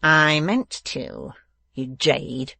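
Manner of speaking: slow, loud, spiteful retort; menacing dwarf husband; nasty dwarf. A narrator's reading. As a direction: throw it back with slow relish. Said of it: "returned the dwarf".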